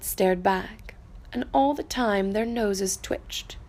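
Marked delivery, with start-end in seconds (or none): none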